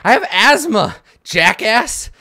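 put-on winded, panting kid voice; out of breath. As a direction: gasp it out breathless. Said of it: "huffing really hard".

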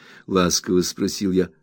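ordinary delivery

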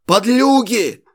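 high furious yell. A narrator's reading. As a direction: scream the insult at them. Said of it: "орал Иван Соболь". (яростный крик)

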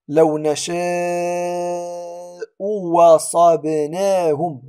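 "لو نشاء وصبناهم" chanted slowly, w replacing the second hamza; إبدال الهمزة واوا. Shown as a demonstration. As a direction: draw out "نشاء", then glide into "وصبناهم" on a clear w. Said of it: "سنقرأها واوا". (تلاوة "وصبناهم" بواو بدل الهمزة، ببطء)

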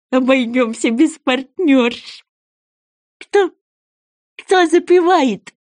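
high, slow rallying creature voice; eager goby. Acted. Goby invites sea wolf to demonstrate, eager.

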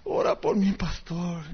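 soft, low, woeful plea; hushed, hammed-up sorrow; mock sad. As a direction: imitate a downcast man sighing for prayers. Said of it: "bien triste y me dijo".

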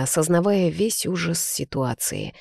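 usual speaking voice

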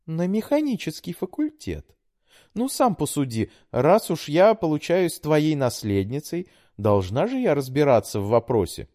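put-on high, pleading young woman; daughter voice. A narrator's reading. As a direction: coax like a sweet daughter. Daughter voice held.

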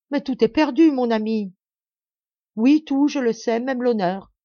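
high, loud desperate cry; anguished. A narrator's reading. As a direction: cry out in despair. Desperate exchange under fire.

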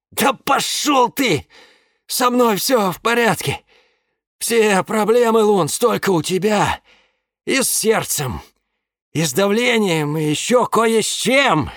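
high, strained angry man's voice; angry drunk man. A narrator's reading. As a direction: snap back at him, high and strained.

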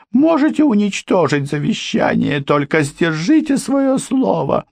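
put-on high, anxious pleading older woman; pleading housekeeper. A narrator's reading. Housekeeper pleading with Ford and the girl, high anxious. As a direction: beg them to keep their word.

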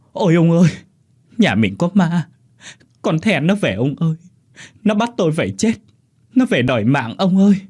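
high, panicky wife's cry; frantic fear. A narrator's reading. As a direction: pour out terror to a husband. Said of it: "Bà ôm chầm lấy ông mà rối rít". (rối rít sợ hãi, giọng cao)

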